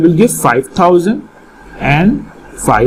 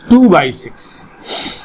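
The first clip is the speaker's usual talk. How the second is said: slow emphasis; much slower than usual. Shown as a demonstration. Teacher stresses the fraction slowly.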